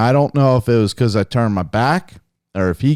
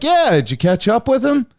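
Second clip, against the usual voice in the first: buddy voice, cheery; 10 semitones higher than usual. Retold or imitated. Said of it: "He's like".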